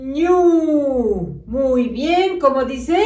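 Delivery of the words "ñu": ÑU pushed out long and loud, pitch falling away; stretched, emphatic ÑU; syllable blending. Shown as a demonstration. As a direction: swell into ÑU from the held sound. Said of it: "La juntamos".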